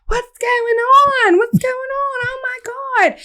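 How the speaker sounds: high-pitched mock panic; alarmed friend. Friend walking up to the cop scene, high mock alarm. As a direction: imitate a frantic friend, voice jumping high.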